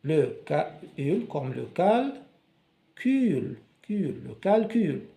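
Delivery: slow, split into syllables; teacher sounding out le calcul syllable by syllable. Shown as a demonstration. Teacher reading aloud to pupils, sounding out each syllable slowly. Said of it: "on découpe les mots pour bien prononcer".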